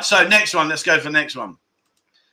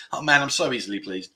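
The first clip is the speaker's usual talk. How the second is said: ordinary talk, nothing marked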